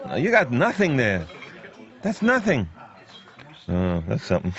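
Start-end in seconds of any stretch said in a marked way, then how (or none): 0.1-2.7 sucking in stomach. Real, not acted. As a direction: still holding the gut in. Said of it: "I suck it in".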